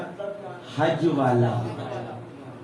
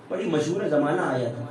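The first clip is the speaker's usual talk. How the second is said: normal talking voice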